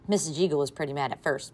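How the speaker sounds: ordinary delivery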